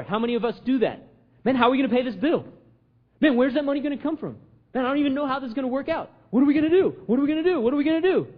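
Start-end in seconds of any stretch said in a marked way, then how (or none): 1.4-8.3 higher, hurried imitation of worriers; mock worried fretting. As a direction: act out mild panic, repeating the question. Voices worried people's fretting.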